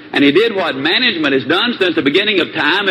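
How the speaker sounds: ordinary talk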